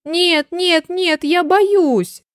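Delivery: high, drawn-out storybook protest; frightened cry. A narrator's reading. Hedgehog refusing to be tossed up, high frightened cry. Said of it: "выкрикнул ёжик".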